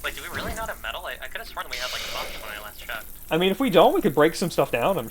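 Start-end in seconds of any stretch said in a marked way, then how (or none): 0.0-3.1 muttering. Real, not acted. Quiet muttering to self.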